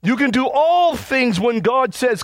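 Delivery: ordinary delivery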